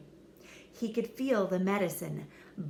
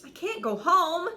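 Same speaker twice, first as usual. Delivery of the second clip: high knight voice; 9 semitones higher, 7 dB louder than usual. Acted. Knight despairs.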